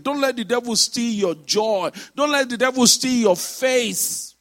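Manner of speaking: emphatic pulpit delivery; forceful preaching. Acted. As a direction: urge the congregation with rhythmic force. Exhorts the congregation.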